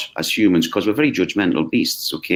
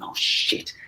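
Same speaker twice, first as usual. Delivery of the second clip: breathless whispered "Oh, shit"; whispered curse; 8 dB quieter than usual. Retold or imitated. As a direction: mutter it under your breath in alarm. Panicked inner thought.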